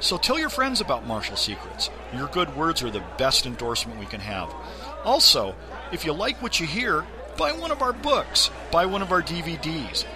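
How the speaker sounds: friendly promo voice for the podcast; ad read. Acted. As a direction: pitch the books and DVDs to listeners.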